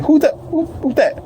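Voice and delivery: high startled girlfriend imitation; high mock girlfriend voice. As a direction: squeal it out, startled. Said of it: "she was like".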